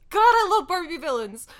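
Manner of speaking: ordinary delivery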